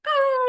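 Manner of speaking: put-on child's wail. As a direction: let out a toddler's sudden wail. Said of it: "he's, like, crying and freaking out".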